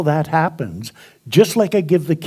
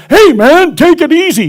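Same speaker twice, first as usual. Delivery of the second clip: put-on much higher, louder alarmed young man; startled student; 12 semitones higher, 8 dB louder than usual. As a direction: jump back and yelp it at the teacher. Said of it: "All of a sudden he goes".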